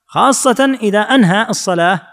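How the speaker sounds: ordinary talk, nothing marked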